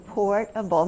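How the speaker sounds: teacher sounding out "portable" in parts; chunked word. Shown as a demonstration. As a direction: separate "port" from "able" in "portable". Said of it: "Can you chunk 'em".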